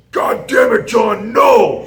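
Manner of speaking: high, loud furious coach shout across the room; Billy Robinson. Retold or imitated. Old coach yelling at his student from across the room, furious shout. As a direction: bellow it like an old British coach who caught you slacking. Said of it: "best Billy Robinson imitation".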